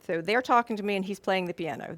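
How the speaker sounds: normal talking voice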